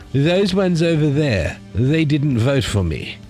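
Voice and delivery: slow, high whiny Trudeau voice; drawn-out sulky politician voice; Justin Trudeau. Retold or imitated. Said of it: "we've got Justin Trudeau here".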